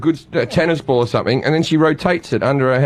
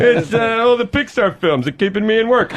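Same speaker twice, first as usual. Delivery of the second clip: Cliff Clavin; 5 semitones higher than usual. Acted. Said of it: "Cliff Clavin".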